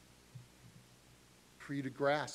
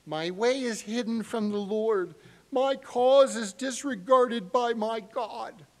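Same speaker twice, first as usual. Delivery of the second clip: higher, louder, slowing on the complaint; pleading complaint; 6 semitones higher, 7 dB louder than usual. Retold or imitated. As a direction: plead it out like a wronged child. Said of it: "You could use a whiny three-year-old voice".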